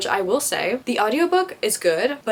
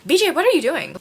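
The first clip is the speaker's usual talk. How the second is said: exasperated; 8 semitones higher, 5 dB louder than usual. Retold or imitated.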